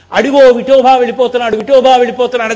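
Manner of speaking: loud, excited calling out, imitated; retold shouting. Preacher imitating the swami crying out at the sky, shouting. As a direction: call it out loudly as the storyteller imitating a cry. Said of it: "అని అరిచారు".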